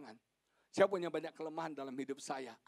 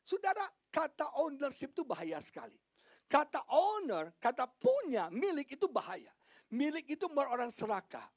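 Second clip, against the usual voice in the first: high, insistent warning building louder; fervent preaching; 10 semitones higher, 7 dB louder than usual. Real, not acted. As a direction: warn the congregation with rising force. Preaches at full force. (khotbah memperingatkan dengan nada tinggi, makin lantang)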